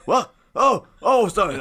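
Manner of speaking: caught not paying attention. Acted. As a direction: act caught off guard, stammer sorry. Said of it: "he just wasn't paying attention".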